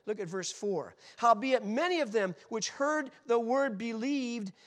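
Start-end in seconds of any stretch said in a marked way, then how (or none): 1.2-4.5 preacher reading Acts aloud to the crowd; preached scripture. Acted. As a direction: proclaim the verse with fire.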